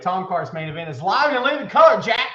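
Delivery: loud, punchy show-opener; hype host intro. Acted. Host hyping his show to viewers in a loud promo voice. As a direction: sell it like a wrestling promo.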